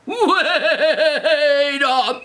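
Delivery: high, loud, long wobbling cry; hugely drawn-out comic alarm; outraged Papa Bear. Acted. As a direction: stretch it into an indignant bellow. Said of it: "then you hear".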